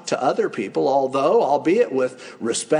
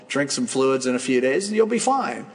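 ordinary talk, nothing marked

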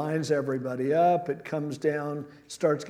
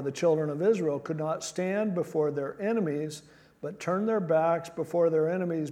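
usual speaking voice

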